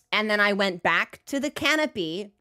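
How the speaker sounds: ordinary talk, nothing marked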